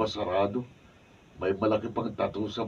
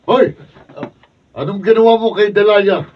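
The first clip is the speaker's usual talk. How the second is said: high, very loud challenge; furious shout; 12 semitones higher, 16 dB louder than usual. Acted. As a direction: shout at the kidnapper across the street. Hero confronting kidnapper. (galit na sigaw)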